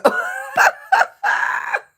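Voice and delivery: very high, loud cackle; helpless high howl of laughter; shrieking laughter. Real, not acted. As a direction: scream with laughter. Screams with laughter at own story.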